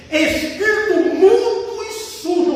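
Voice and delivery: high, slow, forceful command; commanding rebuke, acted. Jesus addressing the deaf and mute spirit, acted commanding rebuke. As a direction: order the spirit out, drawing out the words.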